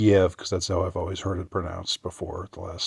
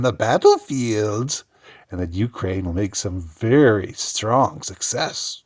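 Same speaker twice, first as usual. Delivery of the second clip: cackling witch voice; 2 semitones higher, 3 dB louder than usual. Retold or imitated. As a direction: read it like a gloating witch. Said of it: "the cackling witch that she is".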